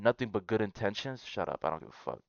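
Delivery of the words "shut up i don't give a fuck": muttered brush-off. Real, not acted. Dismissing the poster.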